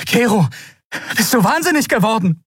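high, agitated cry; appalled outcry. A narrator's reading. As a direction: shout at a friend in horror. Captain sees friend choking man.

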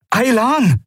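high voiced shout of a name; calling out Aylan. A narrator's reading. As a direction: call the name urgently, pitched high. Kai calls for Aylan.

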